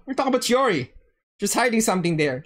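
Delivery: ordinary talk, nothing marked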